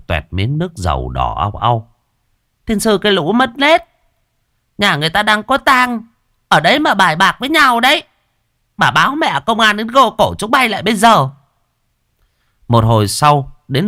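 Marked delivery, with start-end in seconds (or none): 2.7-11.3 put-on high, loud old crone berating the gamblers; scolding old woman. Old woman cursing the gamblers at the wake, shrill scolding. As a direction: spit the words out like a furious granny.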